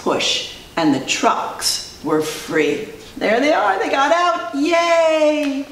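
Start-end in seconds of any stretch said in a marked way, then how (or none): 3.2-5.7 high-pitched happy cheer. Pretend. Teacher cheering the freed trucks, high and happy. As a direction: lift into a long yay.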